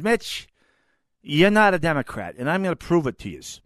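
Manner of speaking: a touch of New York cop's son from Queens; Queens accent. Retold or imitated. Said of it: "in his Queens accent".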